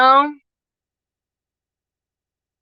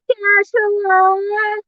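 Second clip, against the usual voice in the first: much higher, drawn-out, delighted hello; excited greeting; 10 semitones higher, much slower than usual. Real, not acted. Greeting Joshua in her chat, high drawn-out delighted hello. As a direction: stretch the hello out to greet a friend.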